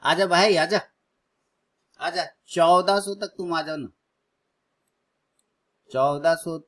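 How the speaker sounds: urging the stock on. Real, not acted. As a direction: egg the price on like a fan. Urging stock price down live. (ज़ोर से उकसाते हुए)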